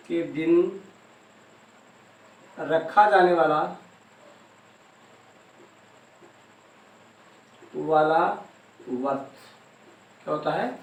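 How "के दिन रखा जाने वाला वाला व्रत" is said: soft, slow phrases with long gaps between; quiet, measured read-out for note-takers; slow dictation. Shown as a demonstration. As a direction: dictate in small pieces, leaving time to write. Dictating phrase piecemeal with long pauses.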